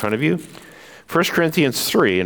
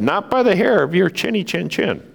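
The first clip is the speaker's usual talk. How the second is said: little pig voice; 7 semitones higher than usual. Acted.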